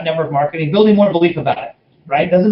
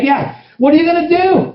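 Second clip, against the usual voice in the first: much higher, excited challenge; pumped-up, pitched-up challenge; fired-up pitch; 12 semitones higher than usual. Retold or imitated. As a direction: push him hard, voice way up. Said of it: "So I'm talking like that".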